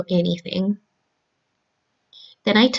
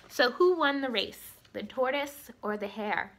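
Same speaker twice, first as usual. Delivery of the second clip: lively storybook reading voice; story narration; 17 dB quieter than usual. A narrator's reading. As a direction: read it like a bedtime story for kids. Reads story to children.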